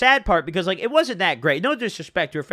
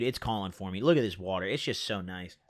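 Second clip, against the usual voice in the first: soft murmur, lower, doting on it; quiet doting murmur; 9 semitones lower, 8 dB quieter than usual. Real, not acted. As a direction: murmur lovingly to the water. Doting on his water.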